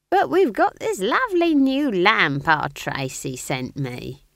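innocent. A narrator's reading. Old witch dodges the candle ritual, innocently. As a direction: sound all innocence, drawing the words out high. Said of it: "said Nanny Ogg innocently".

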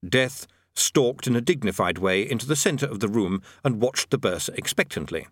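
plain reading voice

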